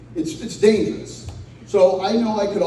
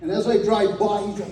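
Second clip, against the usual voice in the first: ordinary talk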